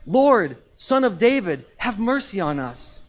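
high, loud cry for mercy; loud acted shout in a scripture reading; shouted plea. A narrator's reading. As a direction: call out from the roadside, drawing out the last words. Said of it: "they shouted".

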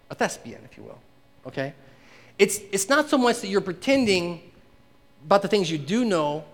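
normal talking voice

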